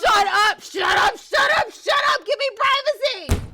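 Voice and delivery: high, loud yell at a sibling; screeching angry teen; furious shout. Acted. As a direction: scream to get them out. Fighting with sibling over room.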